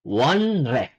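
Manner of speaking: "Wonre" with n and r kept apart; spelling pronunciation. Shown as a demonstration. Korean teacher repeating the spelled word, spelling pronunciation. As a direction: say "Wonre" unmerged, won then re. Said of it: "pronunciation is not wonre".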